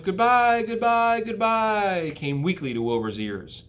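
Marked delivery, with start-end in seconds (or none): 0.0-2.1 high, fading little calls; tiny voices crying goodbye. Acted. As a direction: call goodbye as you drift away. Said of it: "Cries of".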